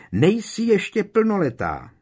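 frantic mother's yell. Acted. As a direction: scream it at your child. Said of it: "křičela paní Weasleyová".

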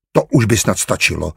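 usual speaking voice

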